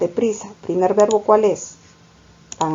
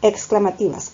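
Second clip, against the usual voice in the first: ordinary talk, nothing marked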